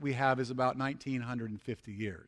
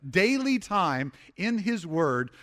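"Daily" stretched and hit firmly; slow down and drive home "Daily"; deliberate, weighty emphasis on "Daily"; stress on daily; 4 dB louder, slower than usual. Real, not acted. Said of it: "emphasis on daily".

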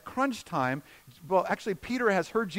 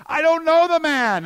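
high, loud, vehement shout; full-voiced outburst, pitched way up; shouted denial; 12 semitones higher, 11 dB louder than usual. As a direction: yell the denial across a courtyard. Peter's denial acted out in story.

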